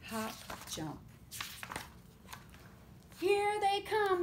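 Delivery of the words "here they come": high, slow frog voice; Betsy's voice. A narrator's reading. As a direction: lift the voice for Betsy. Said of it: "said Betsy".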